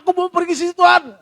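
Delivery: high, loud outburst; defiant shout at God. Acted. Believer talking back rudely to God, defiant shout.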